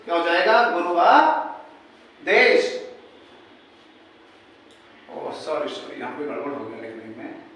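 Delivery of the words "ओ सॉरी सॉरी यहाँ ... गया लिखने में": quiet aside. Real, not acted. Teacher correcting a board mistake.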